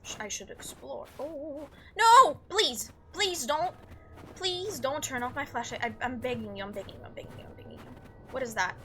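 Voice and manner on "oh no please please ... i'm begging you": panicked begging. Real, not acted. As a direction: beg the game not to kill the light, then give up. Game turns off his flashlight.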